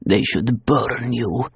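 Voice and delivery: a dark voice from the past; menacing memory voice. Acted. Haunting voice from the past condemning her, menacing.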